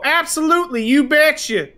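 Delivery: high, bright-sounding "you betcha" with a prairie lilt; overly friendly small-town clerk; cheery clerk. Retold or imitated. Walmart staff answering the phone.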